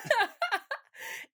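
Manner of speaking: high squealing laugh. Real, not acted. Reacting to the doormat heap joke with a high laugh.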